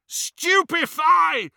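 high, loud, drawn-out spell shout; bellowing. A narrator's reading. Harry casting at the advancing goblins, bellowed spell. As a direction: bellow the incantation slowly, pitched high. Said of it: "he bellowed".

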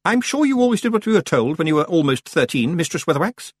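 high, lightly sarcastic witch; mildly pointed, high-pitched jibe; hint of sarcasm. A narrator's reading. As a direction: needle her politely, pitched high. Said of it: "with just a hint of sarcasm".

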